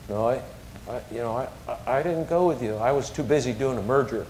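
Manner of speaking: low, soft, halting admission; dejected. Retold or imitated. Hurt dad answering his kids from bed, dejected. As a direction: quietly own up, stumbling over the start.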